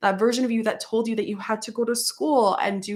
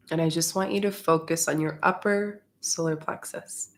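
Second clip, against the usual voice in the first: guided meditation; 3 semitones lower, 5 dB quieter than usual. Acted. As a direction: ease them gently into the breath.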